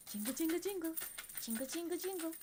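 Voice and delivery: high, chirpy jingling chant; playful attention-getting. Pretend. Streamer jingling money to hold attention, high playful chant. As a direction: coax a distracted baby with jingling keys.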